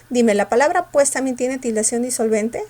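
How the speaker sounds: ordinary talk, nothing marked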